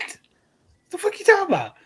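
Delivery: incredulous outburst. Retold or imitated. Retelling exasperated reaction to coworker.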